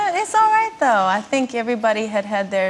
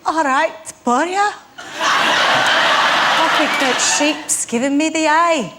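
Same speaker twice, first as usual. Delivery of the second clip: attempted Welsh accent, slow and higher; 4 semitones higher, slower than usual. Guest repeats a hidden phrase for the host, attempted Welsh accent. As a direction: have a rough go at mimicking Welsh. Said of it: "what accent do you think [name] was doing".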